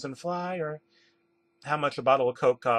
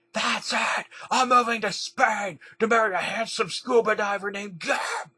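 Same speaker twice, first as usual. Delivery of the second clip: high, mock-tearful outburst; hammed-up upset cry, pitched high; tearful exclaiming; 8 semitones higher than usual. A narrator's reading. Emily announces she is moving to Spain, tearful exclaiming. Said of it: "started crying before exclaiming".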